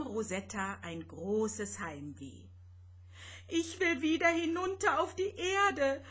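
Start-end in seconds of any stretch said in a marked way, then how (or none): 3.5-6.0 sobbing. Acted. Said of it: "schluchzte sie".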